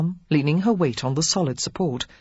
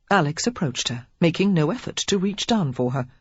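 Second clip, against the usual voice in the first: plain reading voice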